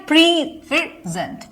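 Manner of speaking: "Present" slowly split, weight on pray; stress on first syllable. Shown as a demonstration. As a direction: stretch and raise pray, then let gent follow in "present". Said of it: "first syllable पे".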